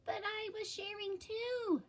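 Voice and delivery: put-on high, lightly whiny young shark voice; a kid protesting in a high voice; Clark's voice. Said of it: "said Clark".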